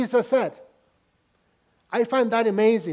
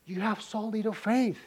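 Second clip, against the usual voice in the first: gentle rebuke; 8 dB quieter than usual. Acted. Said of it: "Jesus says".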